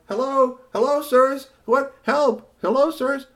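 very high, loud calling; tiny robot calls for help, high and loud; trapped robot calling. Acted. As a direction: call out from inside the oven. Robot stuck in oven calls.

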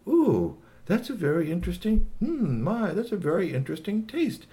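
intrigued alien. Acted. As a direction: savour it with puzzled interest.

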